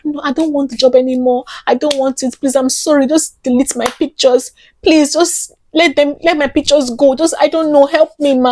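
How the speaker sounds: acted-out frantic pleading, phrases tumbling out; begging. Actor begging to have her pictures deleted, pleading. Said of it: "I was just begging".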